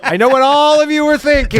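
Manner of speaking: high, hammed-up psychic; hammy psychic voice. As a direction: play a dramatic psychic. Mocking psychic voice.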